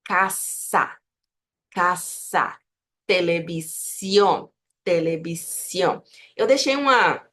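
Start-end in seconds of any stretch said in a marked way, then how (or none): 0.0-2.6 Casa with a long voiceless s; pure S. Shown as a demonstration. Teacher modelling Spanish S, pure S. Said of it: "soa sempre como S". 3.1-6.0 Televisión as telebi-sion, voiceless s; S, not Z. Shown as a demonstration. Teacher modelling Spanish for learners, S not Z. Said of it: "não faz som de Z".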